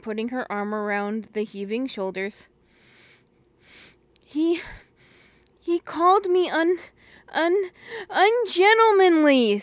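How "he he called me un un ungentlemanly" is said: sobbing. Acted. Little sister to older sister, sobbing the words out. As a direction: choke out the word through tears. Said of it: "sobbed Phyllis".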